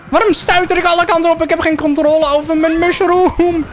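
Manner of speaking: fast, very high squeal; high-pitched frantic complaint; comic panic. Real, not acted. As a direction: squeal it helplessly as things spin out of control. Panicking as character bounces uncontrolled.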